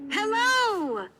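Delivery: the prince calling to the distant gardener; shouted greeting. A narrator's reading. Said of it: "shouted the prince".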